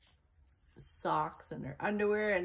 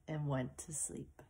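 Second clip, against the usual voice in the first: whispered; 4 semitones lower, 8 dB quieter than usual. A narrator's reading. Girl falls asleep in box.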